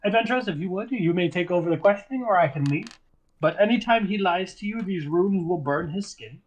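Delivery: a hint of a polite mage; courteous host tone; lightly voiced wizard. Eli addresses adventurers.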